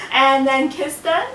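laughing. Real, not acted. Laughing while kissing baby.

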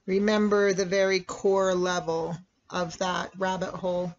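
ordinary talk

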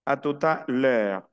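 "A tout à l'heure" slowly as "a tuta lö"; slow, careful French liaison; linked words. Shown as a demonstration. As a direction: model the joined "tout à", then drawn-out "l'heure". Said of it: "entonces esto se une".

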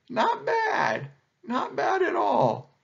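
very high, drawn-out admiration; impressed anteater. A narrator's reading. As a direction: praise it with amazed approval. Anteater admiringly praises Python.

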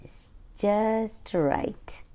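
hushed secret whisper; soft whisper for the child listening. Acted. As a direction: breathe it softly and slowly. Story read aloud.

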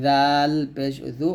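জাল পেশ জু said slowly and light; thin-letter drill; thin light demo. Shown as a demonstration. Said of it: "এটা হবে পাতলা".

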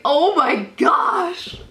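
high, drawn-out cry of disbelief; shocked exclamation. Real, not acted. Fan voicing own reaction to the trade, shocked. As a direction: stretch it out in stunned dismay.

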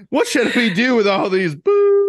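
high, loud, hammed-up posh British; 夸张的英国上流口音; mock posh Tory voice. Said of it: "the last thing they heard was".

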